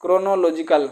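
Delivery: ordinary talk, nothing marked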